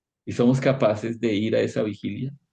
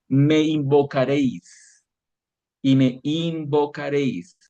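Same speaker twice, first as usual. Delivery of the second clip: slow scripture emphasis; 4 semitones higher, slower than usual. Acted. Repeats verse slowly.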